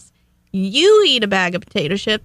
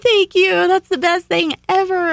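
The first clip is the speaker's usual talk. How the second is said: higher, louder, over-the-top gushing; mock gushing thanks; 8 semitones higher, 4 dB louder than usual. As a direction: ham up the gratitude for a gift.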